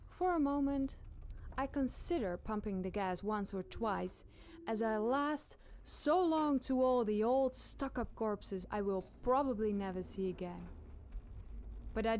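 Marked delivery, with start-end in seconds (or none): none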